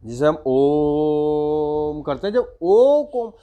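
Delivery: "ओम" held long and slow; slow chanted Om; drawn-out Om. Shown as a demonstration. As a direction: intone "ओम" with a long held vowel. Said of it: "उच्चारण को हम लंबा करते हैं".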